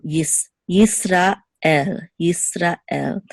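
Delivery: Yisrael with a break before el; separated sounds. Shown as a demonstration. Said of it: "And here too".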